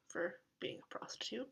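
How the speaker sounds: whispered joke aside. Real, not acted. Telling viewers a cheeky aside, whispered. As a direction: drop to a sly whisper.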